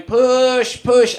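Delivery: high, loud drawn-out command; urging. Retold or imitated. Midwives at the last bit of birth, loud urging. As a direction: urge them on, loud and high.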